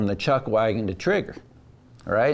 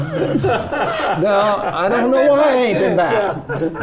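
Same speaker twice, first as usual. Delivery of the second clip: words through laughter, high; laughing; 10 semitones higher, 4 dB louder, much slower than usual. Real, not acted. Guest admitting he never went back, laughing. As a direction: still laughing as he says it.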